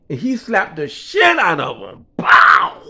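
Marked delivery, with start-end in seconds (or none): 2.2-2.8 slap sound. Retold or imitated. Retelling a hard slap.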